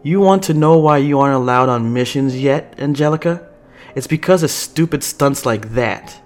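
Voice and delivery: heated, exasperated telling-off; angry scolding. Acted. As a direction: dress down a reckless junior. Chase scolds Angelica angrily.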